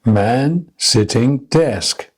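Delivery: slow, even beats on "Man", "sitting", "desk"; three spaced stresses on "Man sitting desk"; one deliberate beat per word, "Man" drawn out and rising; rhythm drill. Shown as a demonstration. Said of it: "stressing only the content words".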